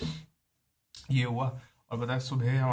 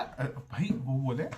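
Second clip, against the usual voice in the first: ordinary talk, nothing marked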